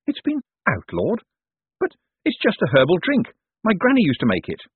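high, fretful voice; puzzled, high-voiced objection; anxious Hubert. A narrator's reading. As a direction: protest in a high-pitched worried voice. Narrator plays Hubert.

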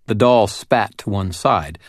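usual speaking voice

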